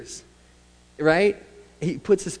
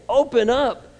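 command; 7 semitones higher, 6 dB louder than usual. Acted. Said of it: "It's a command".

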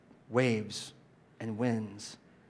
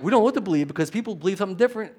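fast, higher mocking whine; mock confusion; 6 semitones higher, 4 dB louder, much faster than usual. Preacher mocking the culture's confusion, fast and high. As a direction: rattle it off like a confused know-nothing.

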